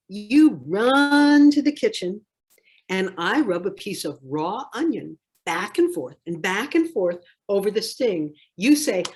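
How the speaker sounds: ordinary delivery, nothing marked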